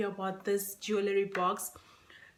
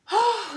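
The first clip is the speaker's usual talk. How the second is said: high, loud emotional "oh"; overwhelmed high sigh; tearful sigh; 10 semitones higher, 8 dB louder than usual. Real, not acted. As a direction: cry out a sigh, moved. Overwhelmed by friend's gift.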